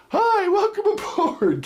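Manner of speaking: high, slow thin pilot greeting; a timid pilot who'd scare you; squeaky nervous pilot. Retold or imitated. Best pilot with a weak voice, high and thin. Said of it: "the best pilot came on and said".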